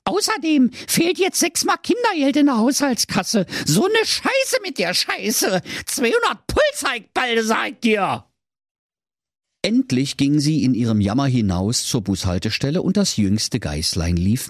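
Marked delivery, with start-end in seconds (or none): 0.0-8.2 high complaining mother voice; whining mother goat. A narrator's reading. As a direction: grumble high like a moaning mum.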